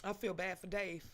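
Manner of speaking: ordinary talk, nothing marked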